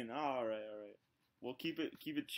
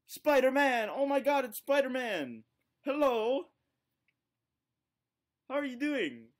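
high, loud fan excitement; mock starstruck; 7 semitones higher, 6 dB louder, slower than usual. Prankster hailing a stranger as Spider-Man, mock starstruck. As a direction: cry out as if starstruck by a superhero.

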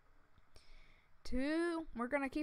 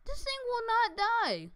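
exasperated cry; 11 semitones higher, 4 dB louder than usual. Acted. Frustrated cry at game enemy.

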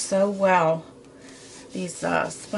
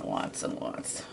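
playful whispered echo of lots and lots, much softer; 12 dB quieter, slower than usual. Pretend. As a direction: breathe the words lots and lots out in a soft mock whisper. Whispered repeat of the phrase.

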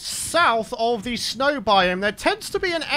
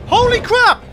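high, loud excited shout; astonished, jubilant yell; thrilled disbelief; 12 semitones higher, 7 dB louder than usual. Real, not acted. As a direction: shout the lucky find to your viewers. Finds top level creature.

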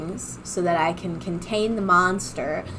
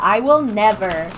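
normal talking voice

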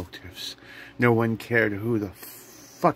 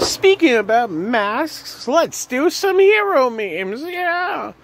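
much higher, louder hype burst; hype shout; 5 dB louder, slower than usual. Acted. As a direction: snap into hype host. Sudden hype switch.

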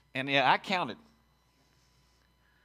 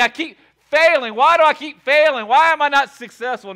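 booming opening question; loud; 6 semitones higher, 9 dB louder than usual. Acted. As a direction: call it out to the whole room. Preacher opens in full voice.

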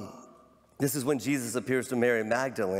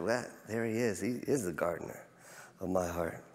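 lower, much softer awe; soft, lower awed murmur; tender hush; 6 semitones lower, 7 dB quieter than usual. Acted. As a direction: murmur it in quiet wonder. Mary voiced, quiet recognition.

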